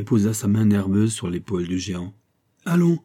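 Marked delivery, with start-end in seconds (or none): 2.6-3.0 higher, louder urgent call; cried out. A narrator's reading. Aramis urgently rousing the sleeping giant Porthos, calling out higher and louder. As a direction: wake him with a sharp cry. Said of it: "cria-t-il".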